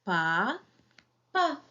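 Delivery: "Pa, pa" as two separate beats, first drawn out and rising; split into syllables. Shown as a demonstration. Teacher sounding out syllables for children, syllable by syllable. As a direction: say "Pa, pa" syllable by syllable, pausing between. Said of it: "où est la syllabe".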